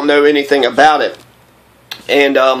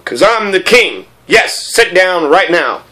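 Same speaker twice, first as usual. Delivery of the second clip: slow, hammed-up royal command; self-important king; slower than usual. King telling the prince to sit, slow mock-commanding. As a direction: draw out each word like a mock monarch.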